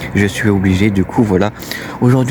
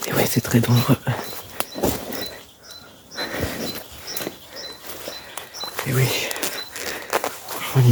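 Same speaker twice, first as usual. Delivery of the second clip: hushed whispering; 2 semitones higher, 6 dB quieter than usual. Real, not acted. Urban explorer whispering on site so neighbours won't hear, hushed. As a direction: keep your voice down, near-whisper with long pauses. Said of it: "je vais chuchoter".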